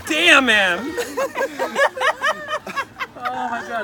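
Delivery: mock curse through a laugh; laughing outburst. Real, not acted. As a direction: blurt it out while cracking up. Reading the letter, laughing.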